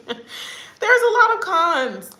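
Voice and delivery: high, loud, slow through laughter; laughing admission. Real, not acted. Vlogger admitting the country's faults, laughing loudly. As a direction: blurt it out laughing, stretched and high.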